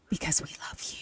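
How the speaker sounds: hushed, low late-night DJ whisper; trying a jazz DJ voice. Pretend. Co-host telling listeners they are loved, in a whispered DJ voice. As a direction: drop into a soft, breathy radio purr. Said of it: "I'm gonna do this".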